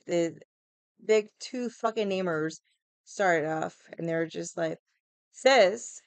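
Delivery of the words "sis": loud, higher exasperated sis. Retold or imitated. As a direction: call it out, fed up. Bands exasperated at lineup.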